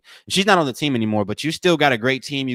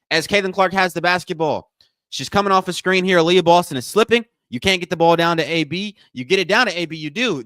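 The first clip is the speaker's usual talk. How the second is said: raised, punchy commentary; live play-by-play; 5 semitones higher, 7 dB louder than usual. Real, not acted. Host calling a screen and pass, animated play-by-play. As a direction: call the action as it unfolds.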